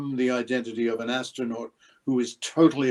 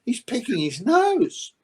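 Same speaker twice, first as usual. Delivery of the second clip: high, slow, incredulous complaint; disgusted aside; 11 semitones higher, 5 dB louder, slower than usual. Retold or imitated. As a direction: drag it out in disbelief. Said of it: "You know".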